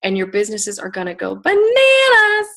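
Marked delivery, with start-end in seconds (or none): 1.3-2.5 loud, high, drawn-out whoop on the word; exuberant hype. Real, not acted. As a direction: belt it out long and high to fire up the crowd. Hypes the group's coming year.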